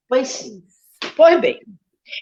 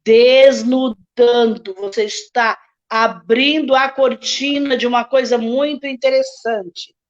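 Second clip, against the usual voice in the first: bem devagar, revelando. Shown as a demonstration. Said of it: "Abre devagarinho assim".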